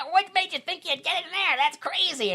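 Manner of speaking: very high put-on voice; imitated high, taunting gang member; mocking cartoon jeer. Voices jeering characters in film.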